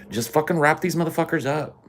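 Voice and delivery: usual speaking voice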